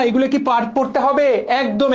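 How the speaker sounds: ordinary delivery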